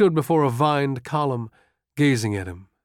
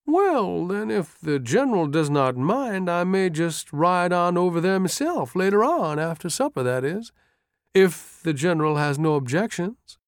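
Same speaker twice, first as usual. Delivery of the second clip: high, hopeful, lilting aide; perked-up, deferential wheedle; brightened sergeant; 6 semitones higher than usual. A narrator's reading. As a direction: ask leave hopefully, pitched well up. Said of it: "The sergeant brightened".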